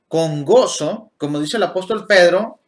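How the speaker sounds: ordinary talk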